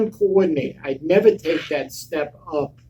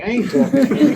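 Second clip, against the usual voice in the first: raised, louder mock outrage; angry refusal; 7 semitones higher, 5 dB louder, faster than usual. To his friend on the phone, refusing with laughing anger.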